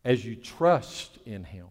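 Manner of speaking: "trust" stressed and drawn out; slow right down and lean on "trust"; deliberate emphasis on the word "trust". Real, not acted. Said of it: "I want to emphasize a different word".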